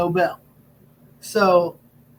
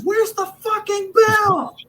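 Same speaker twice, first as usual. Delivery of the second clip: high, drawn-out mock yell; imitating an exasperated shout; wrestler yelling at the ref; 14 semitones higher, 3 dB louder, much slower than usual. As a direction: holler it much higher, stretching out the words. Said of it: "he yells to me".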